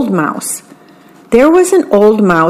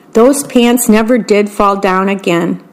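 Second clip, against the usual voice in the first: ordinary delivery, nothing marked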